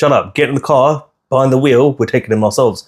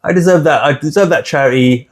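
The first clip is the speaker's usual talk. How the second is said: much higher, meek simpering voice; mock grateful victim; 8 semitones higher than usual. Mocking the listener who accepts charity, high and meek. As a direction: squeak it out like a pushover. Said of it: "And you're saying".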